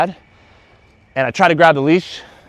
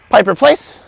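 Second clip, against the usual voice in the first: raised call of the dog's name; firm recall; 7 semitones higher, 7 dB louder, slower than usual. Real, not acted. Trainer calling dog to come, raised. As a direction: call her in with energy.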